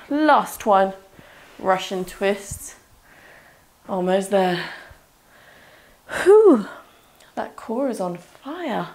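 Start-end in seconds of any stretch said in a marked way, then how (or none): 6.1-6.7 sharp high whew, out of breath; loud breathy whew. Real, not acted. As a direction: blow out a big whew. Trainer mid-exercise, core burning.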